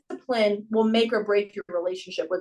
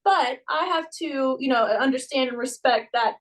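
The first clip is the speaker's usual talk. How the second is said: soothing coach; 5 semitones higher than usual. Retold or imitated. Coach calming sad gymnast, gentle higher voice. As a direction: coax her kindly, pitched up.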